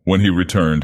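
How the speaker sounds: usual speaking voice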